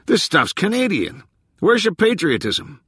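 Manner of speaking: high-pitched mock indignation; good-natured yelled complaint. A narrator's reading. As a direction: holler a playful grievance at the boss. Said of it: "scowling good-naturedly at his can".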